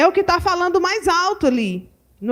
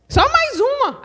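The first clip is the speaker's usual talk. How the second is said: coaxing mother; 10 semitones higher than usual. Retold or imitated. Mother coaxing child to eat.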